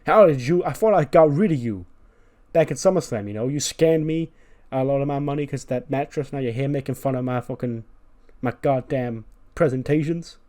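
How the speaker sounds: indignant rant. Acted. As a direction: berate the heckler, easing slightly as it goes. Presenter rants at the heckler.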